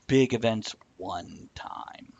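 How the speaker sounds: ordinary delivery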